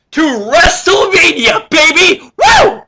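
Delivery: loud, high, slow hype-man yell; hype shout. Real, not acted. Vlogger hyping his viewers for WrestleMania, loud excited yell. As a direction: belt it out like a ring announcer.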